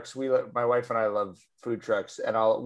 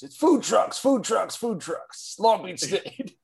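booming high announcer hype; monster truck ad; 9 semitones higher, 3 dB louder, slower than usual. Retold or imitated. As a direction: yell it like a monster truck rally promo. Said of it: "monster truck rally approach".

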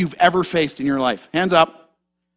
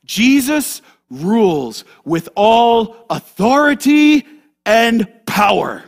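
slow, weighty proclamation; emphatic declaration; slower than usual. Real, not acted. Preacher declaring Christ's rule to the congregation, slow and emphatic. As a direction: space the words out with force.

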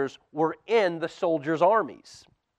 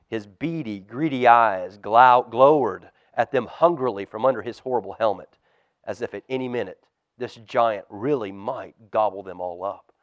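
ordinary delivery, nothing marked

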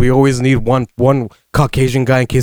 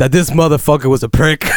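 ordinary talk

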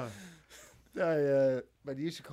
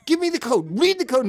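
high, loud exasperated yell; retold yelling; 10 semitones higher, 10 dB louder than usual. Flea yelling at Kenny over the code, loud and high. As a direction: bark demands at the kid fumbling the remote. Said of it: "Flea was yelling at Kenny".

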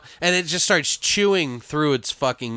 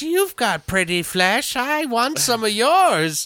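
high, slow, drawn-out demon taunt; mocking demon; 9 semitones higher, slower than usual. Demon taunting Scotty about his flesh, mocking. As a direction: stretch it out high and greedy, mocking the victim. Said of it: "the demon's, like, mocking him".

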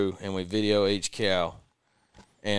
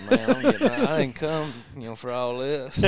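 higher, laughing protest; 6 semitones higher than usual. Real, not acted. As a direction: laugh through the words. Laughing, put on the spot.

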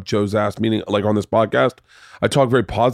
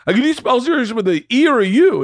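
high, eager question; asking it breathless with delight; so excited; 11 semitones higher than usual. Retold or imitated. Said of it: "I'm, like, so excited".

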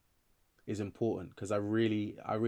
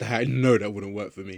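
louder, laughing through the words; real laugh breaking into a self-aware admission; 10 dB louder than usual. Real, not acted. Writer admitting a nine-to-five wouldn't suit them, laughing.

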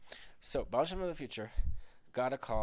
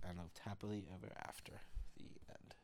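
whispered story ending; 3 semitones lower, 11 dB quieter than usual. A narrator's reading. Bedtime story ending softly.

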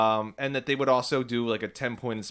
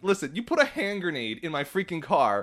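raised-pitch imagined telling-off; frustrated rant; 4 semitones higher, 3 dB louder than usual. Pretend. Customer finally blasting the car guy in his head, frustrated. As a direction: let the pent-up irritation out at him. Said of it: "I get so frustrated over these things".

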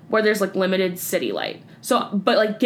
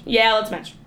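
ordinary talk

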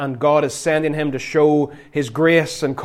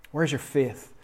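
softly echoed question, much quieter; quiet repeat; 9 dB quieter than usual. Acted. Preacher repeating Jesus to the congregation, hushed. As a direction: let it drop to a soft murmur.

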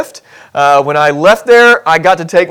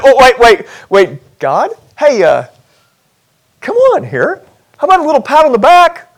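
high, wheedling plea for credit; pipe up; 6 semitones higher than usual. Pretend. Abram piping up to God for a pat on the back, wheedling. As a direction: fish for praise, voice climbing. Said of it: "piped up and said".

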